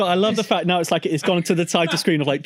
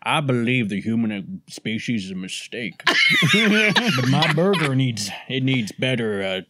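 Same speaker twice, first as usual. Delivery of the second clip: put-on southern accent; 6 semitones lower than usual. Detective in the police car muses over his burger, in a southern accent. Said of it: "he's gone southern suddenly".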